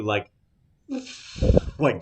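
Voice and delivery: normal talking voice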